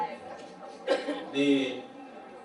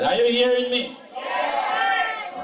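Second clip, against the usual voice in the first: teacher voice; 6 semitones higher, slower than usual. Shown as a demonstration. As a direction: demand the room's attention, teacher style. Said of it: "put on my teacher voice".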